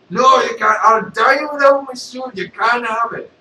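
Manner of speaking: loud alarmed Vader refusing; Darth Vader. Acted. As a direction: refuse loudly, high. Vader refuses his suit.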